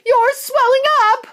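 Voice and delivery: very high loud scream; screaming mother. Acted. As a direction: scream in horror. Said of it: "screamed Missus Beauregard".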